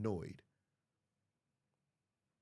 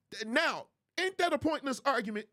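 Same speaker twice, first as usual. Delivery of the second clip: ordinary talk, nothing marked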